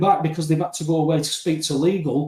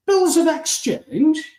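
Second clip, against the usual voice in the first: high, slow, baffled query over the glasses; much higher and slower, mock bewildered judge; puzzled magistrate; 11 semitones higher, 3 dB louder, slower than usual. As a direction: play the magistrate who has never heard of it.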